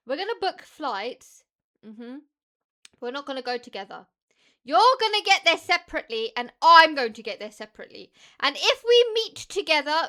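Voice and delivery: high, loud telling-off; mock lecture to mum; loud scolding. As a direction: lay down the law to mum. Lecturing mum about future flights.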